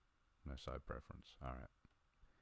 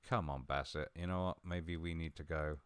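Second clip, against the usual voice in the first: ordinary talk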